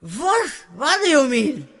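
high, shocked disbelief. Acted. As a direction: react in stunned disbelief. Shocked at arrest.

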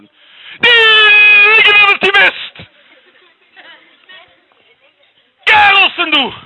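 high loud angry shouting; furious yell. Acted. Sheikh angrily shouting him out of the palace.

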